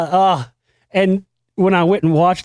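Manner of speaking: ordinary talk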